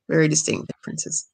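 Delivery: ordinary talk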